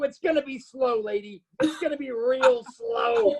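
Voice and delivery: slow, drawn-out threat; mock-ominous warning to the lady; menacing taunt. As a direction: stretch the last words out to tease her. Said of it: "I go".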